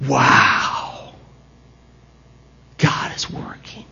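breathless whisper of wonder; whispering to the church that God is at work; awed whisper. Real, not acted. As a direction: hush it, as if struck by awe. Preacher's awed aside.

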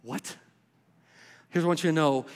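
ordinary talk

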